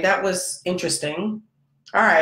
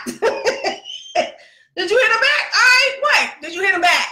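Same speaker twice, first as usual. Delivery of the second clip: excited shout. Retold or imitated. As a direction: demand it with glee, almost shouting. Mother asks again, hyped.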